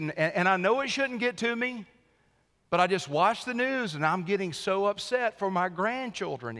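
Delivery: a hint of a fretful friend venting to his pastor; worried church member. Church member tells pastor the news upsets him, mildly voiced. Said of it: "He said".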